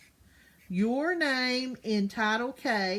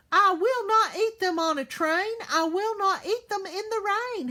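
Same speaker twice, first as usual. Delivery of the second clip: much higher, loud furious refusal; angry shouting; 9 semitones higher, 5 dB louder than usual. Acted. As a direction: shout it like a stubborn kid refusing food. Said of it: "He was using exclamation marks".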